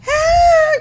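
high mock wail; sudden high-pitched imitation of a child crying; imitated crying wail. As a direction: let out a put-on child's cry. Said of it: "crying, all this commotion".

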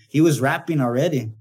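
ordinary talk

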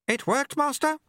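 very high, chirpy character voice; eager apprentice. A narrator's reading. Eager apprentice asks his master.

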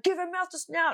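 high-pitched retold plea; frantic urging. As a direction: act out desperate insistence. Acts urging brother to revive dog.

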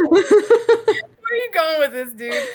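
slow, high, drawn-out mock disbelief; mock incredulous. Retelling her answer to the gym guy, slow high mock disbelief. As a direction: stretch it out like you can't believe him.

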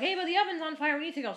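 high, loud urgent call; yelling up. Retold or imitated. Calling roommate down from upstairs, loud urgent yell. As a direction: shout it up the stairs to get her moving.

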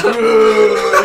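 loud, high mimicked whoop; imitated woo. Teasing a friend stuck on the couch, imitated whoop. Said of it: "And you just went like".

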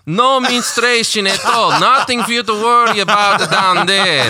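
high, slow mock Ukrainian accent; hammed-up Eastern European sales pitch. Selling a lady to a customer in mock Ukrainian accent. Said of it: "laughing at the Ukrainian accent".

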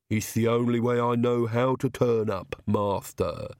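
slightly lisped, deferential servant voice; lisping henchman answering his master; Igor, lisping servant. Acted. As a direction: lisp the word master, obediently.

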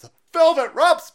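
high, loud scoffing cry; mock disbelief. Mocking the velvet ropes claim, loud high scoff.

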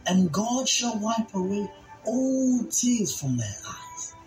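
ordinary talk, nothing marked

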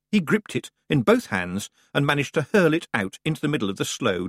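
usual speaking voice

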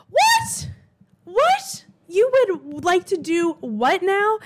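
high, loud incredulous what, then slow; shocked disbelief. Real, not acted. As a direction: shriek your disbelief, then drag out the question. Reacting to a gross message.

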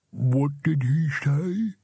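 slow, drawn-out ghostly question; disembodied voice. A narrator's reading. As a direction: ask slowly as a bodiless spirit. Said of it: "said another disembodied voice".

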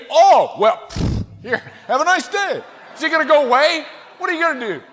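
loud, high scoffing; hammed-up sarcastic outburst with a puff; mock exasperation. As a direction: play it big and incredulous. Mocking officer's imagined reply.

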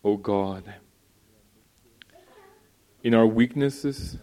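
loud, pleading pulpit prayer; fervent prayer. Real, not acted. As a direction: pray out loud over the congregation. Leads a loud public prayer.

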